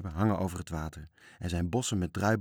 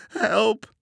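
very high, loud shriek; yell for help; 9 dB louder than usual. A narrator's reading. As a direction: scream out in alarm. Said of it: "gilde Toontje".

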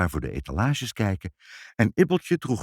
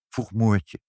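ordinary delivery, nothing marked

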